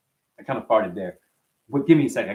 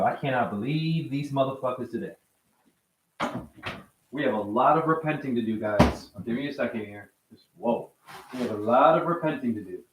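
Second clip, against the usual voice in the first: softer, heavy disbelief; subdued anger; 4 semitones lower, 8 dB quieter than usual. Real, not acted. Streamer telling chat they must repent, quietly shaken. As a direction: let it sink in, voice dropping. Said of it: "I'm still angry about it".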